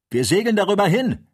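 high, loud cry of triumph; jubilant shout. A narrator's reading. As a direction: call it out in relief and victory. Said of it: "rief jetzt eine Stimme".

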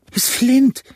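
a pirate's panicked shriek; terrified scream. A narrator's reading. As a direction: shriek the name in sheer terror. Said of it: "schrie Mary".

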